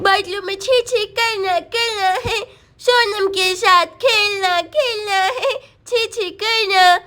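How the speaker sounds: put-on slow, babyish little-boy voice; babbling toddler. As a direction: babble to yourself like a tiny child. (छोटे बच्चे की तोतली बोली)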